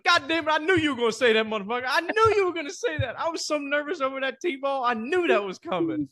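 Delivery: very high-pitched mock exasperation; exasperated outburst. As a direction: squeal it like someone who saw it coming. Acts out friend jumping off tee.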